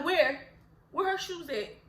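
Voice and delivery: eager where. Retold or imitated. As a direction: snap your head round, eager to look. Mock eager gossip reaction.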